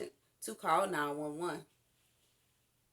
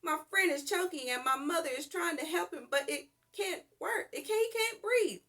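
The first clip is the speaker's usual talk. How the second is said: panicked; 8 semitones higher, 3 dB louder than usual. A narrator's reading. Child reporting choking friend.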